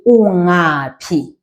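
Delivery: Ungaphi drawn out very slowly, each syllable clear; teacher slowing Ungaphi right down; slow demo word. Shown as a demonstration. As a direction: spell out Ungaphi slowly for the learner. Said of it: "you will say".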